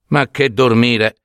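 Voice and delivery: ordinary delivery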